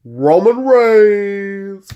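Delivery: drawn-out, high, loud name call; hyped announcer call. Acted. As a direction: stretch the name like a ring announcer.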